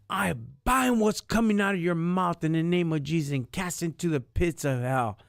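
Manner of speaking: high, forceful binding prayer; commanding rebuke. Retold or imitated. A minister rebuking the caseworker in court, forceful high command. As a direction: cast it out with power and authority. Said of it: "with power and authority".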